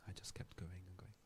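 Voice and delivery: hushed, breathy whisper of awe. Real, not acted. Telling the audience about his endless recitation, whispered. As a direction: drop to a whisper as if telling a secret.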